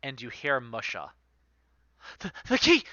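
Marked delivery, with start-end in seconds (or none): 2.2-2.8 high, loud frantic cry; panicked cry. Acted. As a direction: cry out as the key goes missing. NPC panics over lost key.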